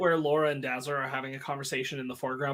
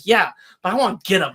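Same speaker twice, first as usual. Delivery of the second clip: eager for revenge; 5 semitones higher, 7 dB louder, faster than usual. Retold or imitated. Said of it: "it's like".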